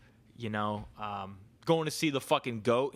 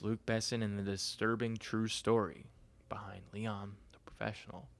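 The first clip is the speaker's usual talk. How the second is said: whispered headline; 6 dB quieter than usual. Acted. Reading a headline aloud.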